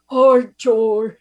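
lightly voiced Bernie-like greeting; a hint of Bernie's voice; imitating Levy. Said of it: "I expect him to be talking like".

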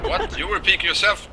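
sudden high startled what; shocked. Real, not acted. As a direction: yelp it out in surprise. Shocked reaction in game.

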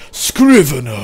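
a loud call aimed at a deep giant voice; meant to be deep. Acted. Streamer testing a deep Hakon voice, loud call. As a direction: boom the name out, going for deep. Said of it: "maybe it should be really deep".

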